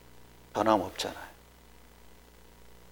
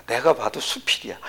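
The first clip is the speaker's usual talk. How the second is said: ordinary talk, nothing marked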